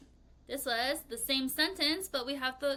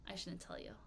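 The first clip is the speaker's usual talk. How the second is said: whisper; 5 semitones lower, 13 dB quieter than usual. Real, not acted. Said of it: "whispered secret".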